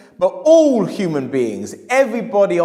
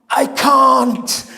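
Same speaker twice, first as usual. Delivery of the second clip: higher, louder, crying; mock tearful; 8 semitones higher, 6 dB louder than usual. Customer refusing the book, mock crying. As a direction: choke out the refusal.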